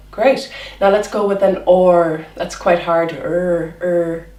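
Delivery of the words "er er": a throaty er made at the back of the tongue; back-of-mouth er. Shown as a demonstration. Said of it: "It's at the back".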